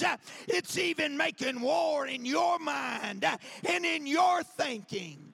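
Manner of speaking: fiery preaching to the congregation. Acted. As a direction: preach it to the rafters. Preaching at full force.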